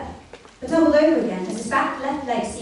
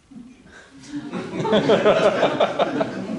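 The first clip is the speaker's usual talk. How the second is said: laugh; 2 semitones higher, 8 dB louder than usual. Real, not acted. Narrator laughs.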